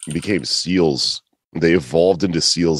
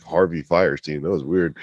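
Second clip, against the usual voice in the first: normal talking voice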